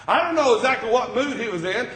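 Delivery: ordinary delivery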